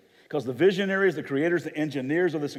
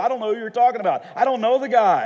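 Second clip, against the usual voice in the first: raised voice, high and rushed, building; heated denial; 11 semitones higher, 10 dB louder, faster than usual. Acted. Peter to second accuser, loud insistent denial. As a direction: deny it louder, rising to near shouting.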